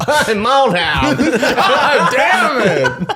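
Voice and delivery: slow, high drawl through laughter; mock his drawled intro, giggling; imitating Mild Hound. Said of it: "I don't sound like that".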